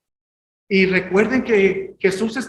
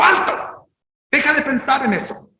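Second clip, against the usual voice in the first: firm command; 5 semitones higher than usual. Shown as a demonstration. Preacher showing how he now commands his thoughts. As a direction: order the mind to halt. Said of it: "Ahora digo".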